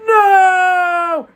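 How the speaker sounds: high, loud, drawn-out wail; anguished scream. Acted. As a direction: scream it in hammed-up despair. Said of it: "puts his hands on his head".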